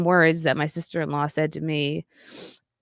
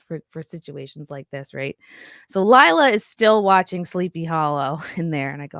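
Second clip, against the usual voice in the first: ordinary delivery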